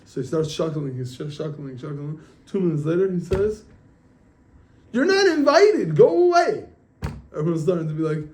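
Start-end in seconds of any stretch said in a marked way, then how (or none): 4.9-6.6 very high, loud, drawn-out banishing shout; big storyteller's shout; retold scream. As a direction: act out yelling an intruder away. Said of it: "he screams out".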